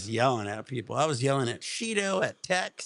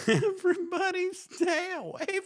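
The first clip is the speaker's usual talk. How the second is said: high, slow, whimpering mock oil man; oil guy from The Jerk; slower than usual. Streamer imitates the oil guy warning everyone away in a high whimper. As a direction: wail it like a weepy crank in a comedy. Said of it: "like the oil guy from The Jerk".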